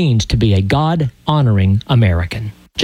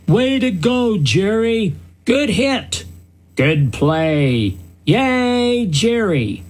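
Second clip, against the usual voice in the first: high-pitched sideline cheer, imitating a proud mother; cheering mom; 7 semitones higher, slower than usual. Mom in the stands cheering for her son, high mock cheer. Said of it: "when she spoke loudly".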